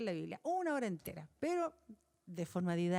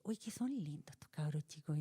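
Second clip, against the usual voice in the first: soft, lower fond voice; tender; 5 semitones lower, 5 dB quieter than usual. Retold or imitated. Teacher seeing her pupils anew: soft, fond aside. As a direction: melt a little over the kids.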